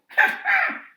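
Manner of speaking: high chuckling laugh; parrot chuckle. A narrator's reading. Parrot chuckling at the proud creatures. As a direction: chuckle knowingly.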